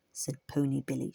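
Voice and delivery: ordinary delivery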